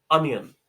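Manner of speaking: अनियन said firmly, AH-nyun; clear correct onion. Shown as a demonstration. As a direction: model अनियन, stressed first syllable, falling. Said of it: "इसका actual pronunciation होता है".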